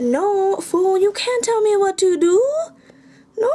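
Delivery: high, mock-indignant comeback; sassy defiant retort. Girl refusing the journal's order to leave a page blank, sassy defiant retort.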